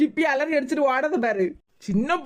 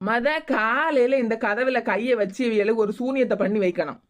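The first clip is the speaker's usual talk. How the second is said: witch voice; 3 semitones lower than usual. Acted. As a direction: plot the curse like a scheming witch. Plotting a curse like a witch.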